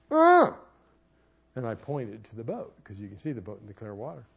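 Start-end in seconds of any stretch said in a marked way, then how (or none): 0.1-0.6 loud high yell through a mouthpiece; underwater yell. Retold or imitated. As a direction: yell to warn your family. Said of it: "And I went".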